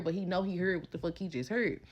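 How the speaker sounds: normal talking voice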